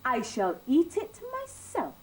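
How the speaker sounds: a hint of a smug little hen. A narrator's reading. Little red hen refusing the others, lightly smug. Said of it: "said the little red hen".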